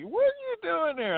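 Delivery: very high mock Tennessee voice; Tennessee accent. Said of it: "trying to do my best Tennessee accent".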